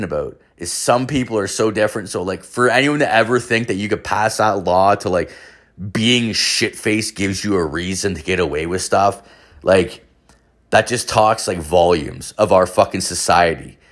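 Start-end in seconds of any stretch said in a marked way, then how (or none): none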